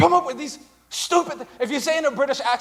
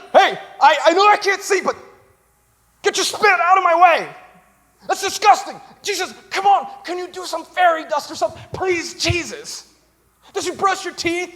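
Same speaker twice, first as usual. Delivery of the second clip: raised, higher-pitched complaint at Jesus; blind man complaining; 6 semitones higher, 3 dB louder than usual. Retold or imitated. Blind man scolding Jesus for spitting, raised complaint.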